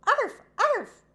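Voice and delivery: high, loud yappy arf; puppy bark. A narrator's reading. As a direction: bark like a tiny excited puppy. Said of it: "she barked".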